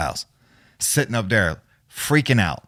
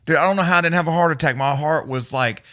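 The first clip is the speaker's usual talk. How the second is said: normal talking voice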